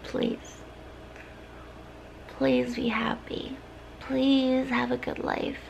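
soft, breathy good wishes, mostly unvoiced; whispered blessings. Saying goodbye to the viewer in a soft whisper. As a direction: whisper every wish tenderly.

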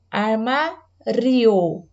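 armário drawn out very slowly with the R sounded; showing the R in armário. Shown as a demonstration. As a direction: stretch armário, leaning on the R. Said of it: "Outra palavra que faz o R tremer". (armário bem devagar, destacando o R)

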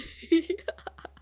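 high giggle; laugh. Real, not acted. Streamer laughs at calling the raider man, giggling. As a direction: giggle at your own slip.

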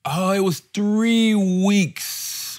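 slowly stretched out three weeks; drawn out. Real, not acted. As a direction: drag out the words with pride. Stretched proud correction.

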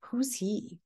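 hushed, curious whisper. Acted. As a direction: lean in and whisper the question. Teacher whispers a question to class.

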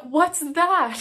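loud, high retold disbelief; bewildered outburst. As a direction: blurt the question, completely thrown. Retells bewildered reaction to diagnosis.

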